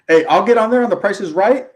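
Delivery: usual speaking voice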